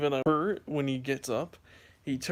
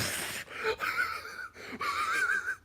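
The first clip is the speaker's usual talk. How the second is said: very high wheezing squeal of laughter; imitated laughing fit; 4 dB quieter than usual. Reacting to brother's joke, high squealing laughter. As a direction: re-enact a helpless, squeaky laugh. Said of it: "I was laughing so".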